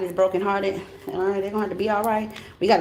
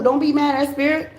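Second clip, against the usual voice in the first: normal talking voice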